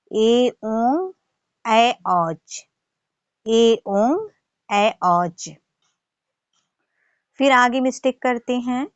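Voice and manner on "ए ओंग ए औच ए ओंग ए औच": ए ओंग and ए औच said slowly and clearly; correct pronunciation. Shown as a demonstration. Teacher models correct sutra sounds, slow and clear. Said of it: "इसका शुद्ध उच्चारण है".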